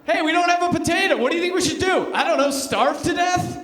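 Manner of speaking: lightly voiced, high panicky peasants; mock Irish peasants. Comic acting out famine villagers, mock panic. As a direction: play it as starving villagers, laughing.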